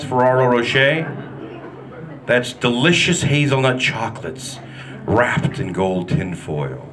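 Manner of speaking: normal talking voice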